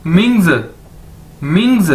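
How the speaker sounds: "मिंग्स" joined as ming-z, falling tone; model pronunciation. Shown as a demonstration. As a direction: drill "मिंग्स", ming running into a buzzed z. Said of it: "इकट्ठा कर दिए तो क्या बन जाएगा".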